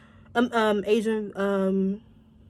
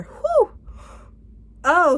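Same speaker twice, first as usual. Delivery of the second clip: swooning whew; 7 semitones higher, 3 dB louder than usual. Real, not acted. Swooning over actor.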